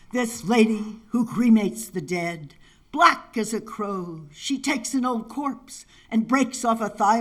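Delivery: slow, measured poem recital; poetry reading. Acted. Poet recites Mahakala Speaks to the audience, measured poetry reading. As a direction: weigh each image with deliberate phrasing.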